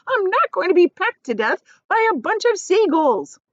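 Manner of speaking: high, loud defiant cry; cried. A narrator's reading. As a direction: refuse stubbornly. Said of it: "cried the earthworm".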